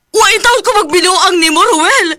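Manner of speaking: loud, high outraged reply; angry protest. Acted. Wife furiously denying his accusation, loud and high. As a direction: fire back at him.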